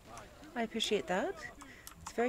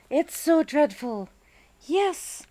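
comforting the dog; 6 semitones higher, 8 dB louder, slower than usual. Real, not acted.

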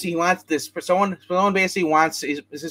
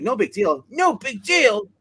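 a scolding aunt throwing his words back; mock angry echo; 7 semitones higher, slower than usual. Aunt scolding her nephew, repeating his words in mock anger. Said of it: "She yells at him".

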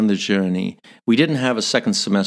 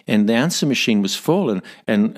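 normal talking voice

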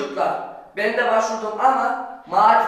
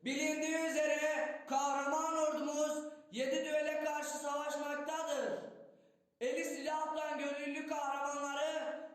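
town crier; 3 semitones higher, 13 dB quieter than usual. Acted. Town crier calling out, distant.

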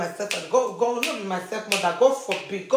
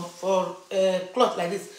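ordinary talk

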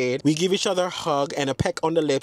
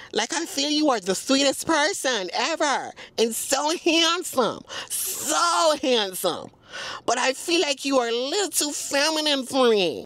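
sweet Southern Belle; 6 semitones higher than usual. Retold or imitated. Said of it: "He said".

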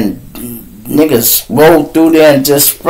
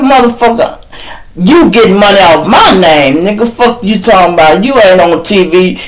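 heated retort; 7 semitones higher than usual. Retold or imitated. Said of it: "arguing on the phone".

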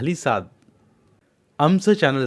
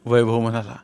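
ordinary delivery, nothing marked

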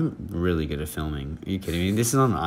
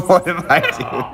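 laughing; 10 semitones higher, 5 dB louder than usual. Real, not acted. Laughing at himself.

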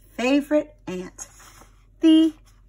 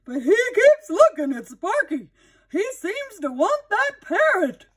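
puzzled shopkeeper; 11 semitones higher, 5 dB louder than usual. Acted. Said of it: "said Mister Jones".

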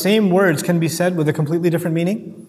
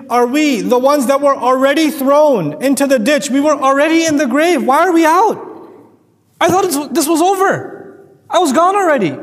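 higher, rising disbelief; bewildered resurrected voice; 5 semitones higher than usual. Acted. Preacher voicing the resurrected, higher and stunned. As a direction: voice someone waking from the grave, stunned.

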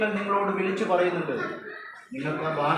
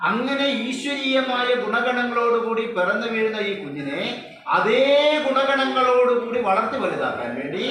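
slow, loud, higher delivery; rousing preaching; 6 semitones higher, 5 dB louder, slower than usual. Acted. Speaker preaching to parents about the divine child, loud and slow. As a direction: lift the room with each phrase.

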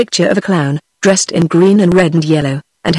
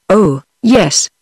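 usual speaking voice

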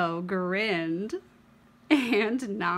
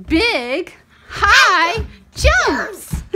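higher and louder, building up; growing storytime delight; excited; 9 semitones higher, 9 dB louder, much slower than usual. Acted. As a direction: let it soar with the jumps. Bigger jumps in the story.

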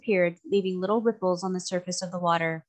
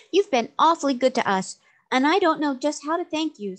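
put-on sincere girlish thanks pitched higher; earnest; 7 semitones higher, 4 dB louder than usual. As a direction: thank them from the heart, voice lifted. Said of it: "said Trot earnestly".

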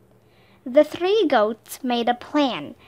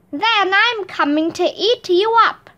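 high, louder mock troll roar; 6 semitones higher, 3 dB louder than usual. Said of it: "roared the troll".

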